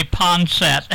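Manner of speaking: ponset in two syllables, PON-set; Madge Cooper's pronunciation. Retold or imitated. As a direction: say ponset with no oy and no final vowel. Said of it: "used to say ponset".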